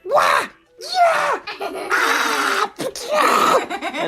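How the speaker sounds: high, shouted martial-arts yelps; kung fu battle cries. Acted. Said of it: "'Cause Jerry was like".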